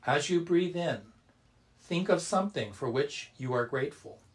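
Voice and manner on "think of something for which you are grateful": usual speaking voice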